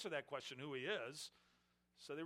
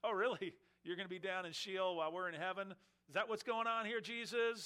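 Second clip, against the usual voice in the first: higher and louder, sarcastic scoffing; mocking; 4 semitones higher, 5 dB louder than usual. Leaders mocking Jesus about Sheol, sarcastic scoff. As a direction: scoff at him like he's absurd. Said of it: "mocking him and saying".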